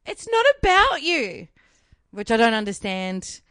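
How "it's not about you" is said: higher, slow dismissive brush-off; imitating the stranger. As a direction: brush her off, lightly mocking. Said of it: "she was like".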